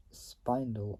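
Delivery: Spindle said as SPINE-dul; long-i pronunciation. Shown as a demonstration. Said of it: "I don't know how you pronounce it".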